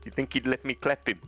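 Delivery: hammed-up South African bravado; South African accent. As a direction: play a cocky South African egging someone on. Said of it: "But then the South African came out".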